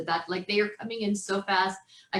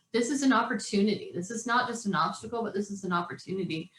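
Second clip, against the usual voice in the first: usual speaking voice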